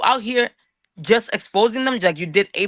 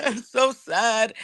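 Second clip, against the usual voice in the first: slow, put-on whiny self-pity; mock self-pity; much slower than usual. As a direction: drag it out like a sulking kid. Mocking himself to Henry.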